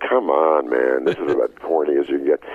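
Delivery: low, unimpressed brush-off; mock dismissal. Retells own dismissal of wife's plea.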